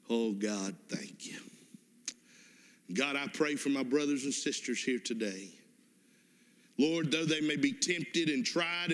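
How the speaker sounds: earnest closing prayer. Real, not acted. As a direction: pray over them with feeling. Closing prayer over music.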